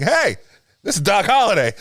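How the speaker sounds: loud, high mock introduction; Wyatt Earp. Wyatt introducing Doc to needle the sheriff, loud mock call-out. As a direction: call it out pointedly, imitating a troublemaker. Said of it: "Wyatt was kind of being a dick and calling the sheriff over, saying".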